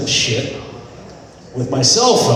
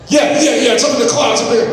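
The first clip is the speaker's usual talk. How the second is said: excited shout; 9 semitones higher, faster than usual. Retold or imitated. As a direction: bark it out like you're sure you're right. Kevin insists photo's in cloud.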